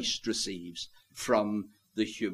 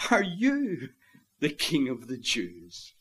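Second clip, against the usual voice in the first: contemptuous pause after "you", letting the rest fall away; curiosity and contempt; 6 dB quieter than usual. Acted. Pilate questioning the prisoner before him, curious contempt. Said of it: "a mixture of curiosity and contempt".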